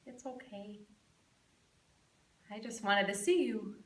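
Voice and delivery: very soft, low and halting; tender reassurance. Acted. Sick son comforting his mother from his pillow, softly tender. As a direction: let it sink almost to nothing.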